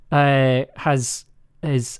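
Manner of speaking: usual speaking voice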